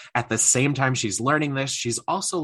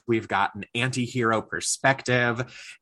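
normal talking voice

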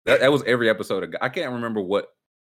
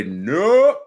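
a sharp high loud No; firm loud no; 8 semitones higher, 7 dB louder than usual. Retold or imitated. To the employee, a loud flat refusal. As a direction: shut it down hard. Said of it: "I would say".

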